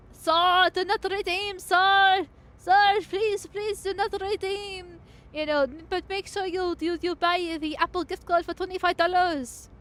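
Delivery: high, pleading mock Indian accent; bad Indian accent. Shown as a demonstration. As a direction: plead in a hammed-up Indian accent, loud on each "sir". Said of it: "uh, the Indian one".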